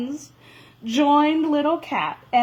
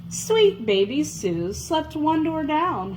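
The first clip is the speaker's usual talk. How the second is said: ordinary delivery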